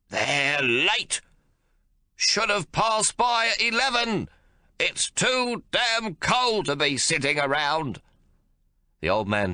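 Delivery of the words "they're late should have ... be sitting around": put-on high, loud, slow cranky old man complaining; cantankerous old father fuming; irascible old man. A narrator's reading. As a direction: grumble like a furious old codger. Old man figure held.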